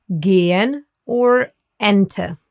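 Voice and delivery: "gehen or Ente" with pure eh vowels; slow, careful "gehen" and "Ente", E as eh; German E. Shown as a demonstration. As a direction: model German "gehen", "Ente" slowly for a learner. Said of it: "pronounced like the E in bed".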